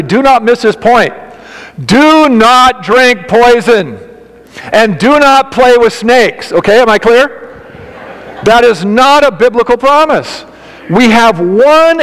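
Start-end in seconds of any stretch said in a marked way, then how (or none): 1.9-6.5 slow, higher, each word pressed hard; emphatic warning. Real, not acted. Pastor warning the congregation against snake handling, slow and emphatic. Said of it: "I want to say to you emphatically".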